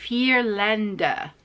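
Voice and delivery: vier Länder slow, vier with an f and Länder with an extra e; German vier Länder. Shown as a demonstration. Trying the German for viewers, careful and slow. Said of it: "you pronounce the E at the end".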